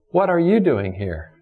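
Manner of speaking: YOU lifted, then falling away; stress on YOU. Shown as a demonstration. Preacher asks God's question of Elijah, stress on YOU. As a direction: lean on "you", let the rest drop. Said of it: "emphasize a different word".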